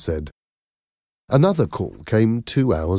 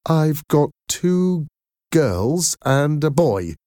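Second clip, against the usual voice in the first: slow, higher, wary admission; nervous, expecting rejection; 9 semitones higher, 3 dB louder, slower than usual. A narrator's reading. Mother telling a caller about her children, nervous. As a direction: spell it out hesitantly, braced for a no. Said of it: "expecting a negative response".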